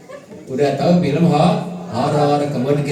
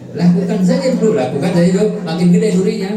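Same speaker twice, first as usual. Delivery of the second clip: urging; 8 semitones higher, 7 dB louder, faster than usual. Acted. Preacher urging loudly.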